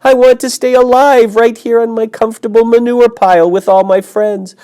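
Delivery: very high, loud tearful wail; moaning. Acted. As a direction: wail it out through tears. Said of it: "he moaned".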